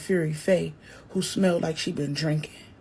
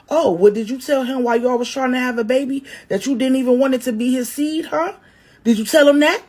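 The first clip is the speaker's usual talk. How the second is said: fast, loud, higher comeback; snapping back; 5 semitones higher, 7 dB louder, faster than usual. Acted. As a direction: fire it back, building to the last question. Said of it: "Kiwi sharks back".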